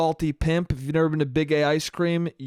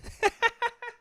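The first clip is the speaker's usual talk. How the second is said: high creepy giggle; 3 dB quieter than usual. Retold or imitated. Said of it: "creepy fucking Italian voice".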